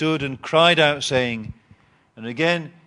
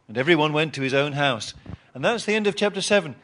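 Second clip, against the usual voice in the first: usual speaking voice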